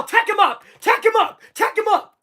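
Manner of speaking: mock yelling at a ref; high-pitched demand in short repeated bursts; Draymond. Streamer yelling as the player at the ref, mock outrage. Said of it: "Draymond's gonna yell".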